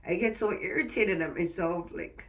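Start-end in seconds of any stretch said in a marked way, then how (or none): none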